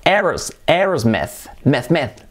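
"Aerosmith" slowly in parts, ers, ers-meth; slow syllable drill, AIRS-meth; broken-down pronunciation. Shown as a demonstration. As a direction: stress the E in "meth". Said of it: "es una E".

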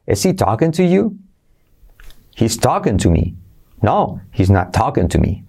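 sing-song exaggerated intonation; exaggerated tune. Shown as a demonstration. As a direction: question rising, answers falling, overdone. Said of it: "Lo estoy exagerando un poco".

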